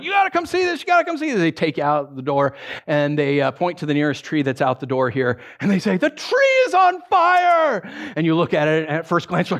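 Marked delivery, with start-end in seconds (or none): 0.0-1.4 high, excited urging; acted urgency. Friend dragging you out the church door, acted urgency. 6.0-7.8 high, loud, drawn-out alarm cry; acted alarm shout. Friend pointing at the nearest tree, acted alarm shout.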